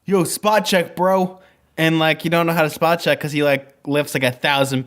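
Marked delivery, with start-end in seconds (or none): 0.1-1.4 bro voice. Pretend. Imitating the bro character.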